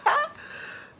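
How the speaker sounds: high squeal into laughter; bashful laugh. Real, not acted. Reading a viewer's compliment, laughing shyly up high. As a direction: giggle off the compliment. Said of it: "Beautiful pot seller".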